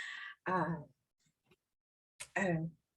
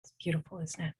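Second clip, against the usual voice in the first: soft reassuring; 10 semitones lower, 7 dB quieter than usual. Acted. Calming someone before a tense moment.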